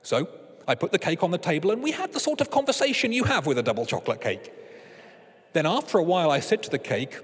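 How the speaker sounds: ordinary talk, nothing marked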